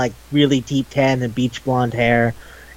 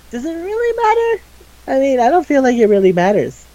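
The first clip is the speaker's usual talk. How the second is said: lightly acted high-pitched character; put-on high voice, retelling a scene; retold character's voice; 11 semitones higher than usual. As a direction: voice the retort way up high.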